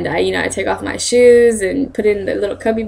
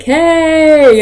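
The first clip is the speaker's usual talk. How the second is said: friendly hey; 8 semitones higher, 7 dB louder than usual. Retold or imitated. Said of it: "they're always like".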